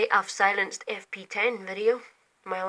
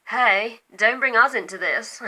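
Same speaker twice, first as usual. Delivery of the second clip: loud character voice; 2 semitones higher, 11 dB louder than usual. Retold or imitated. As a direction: shout it like a cartoon character butting in. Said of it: "That's sort of a".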